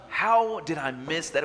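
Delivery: baffled at his own oversight, drawn out; exasperated disbelief. Retold or imitated. Man retells finding pencil in cup holder, incredulous.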